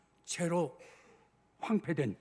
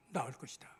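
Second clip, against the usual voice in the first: hushed whisper; 5 semitones lower, 8 dB quieter than usual. Retold or imitated. Preacher voicing God's promise to Abraham, in a whisper. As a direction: whisper the promise, unvoiced and soft.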